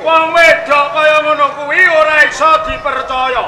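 high, forceful declamation; loud pronouncement. Acted. Regent warning his audience about such women, in loud declamation. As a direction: proclaim it loudly as a warning.

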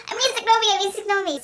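high-pitched mock panic; flustered backtracking. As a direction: blurt a frantic correction, voice shooting up. Panicked correction in retold story.

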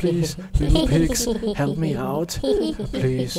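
pleading through laughter; laughing plea. Real, not acted. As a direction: beg while laughing.

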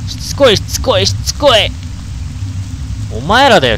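fed-up shout at the pests; irritated yelling. Real, not acted. Ghosts keep coming. (うんざりして叫ぶ)

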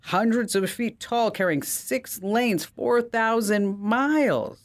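high, incredulous genie refusing a bridge; exasperated genie. Acted. Genie to the man wanting a bridge, high incredulous. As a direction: play the genie boggled by the request. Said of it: "the genie leans back and goes".

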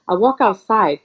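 ordinary talk

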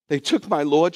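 loud, high, distraught outburst; crying woman, acted. Weeping Miriam pleads with the angels, loud and distraught. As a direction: cry out in mock anguish. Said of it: "She's crying and she's got mucus".

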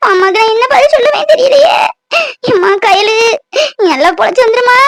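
put-on old grandmother's voice, sobbing through her story; tearful grandmother. Grandmother tells the nurse how her granddaughter got hurt, tearful.

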